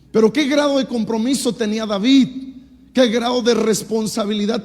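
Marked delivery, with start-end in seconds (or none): none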